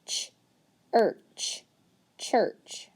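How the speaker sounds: segmented ch, er, ch, then church blended; teacher sounding out a word slowly. Shown as a demonstration. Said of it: "So let's sound it out".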